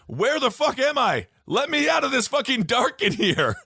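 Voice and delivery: high-pitched comic outcry; mock-indignant call from the casket; trapped dead man. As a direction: cry out as the man shut in his coffin.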